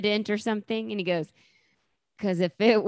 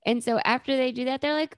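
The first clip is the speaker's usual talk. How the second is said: usual speaking voice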